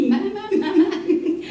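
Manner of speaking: high, laughing my my my; amused surprise. Acted. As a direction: marvel at it with a chuckle. Doc reacts to the test result.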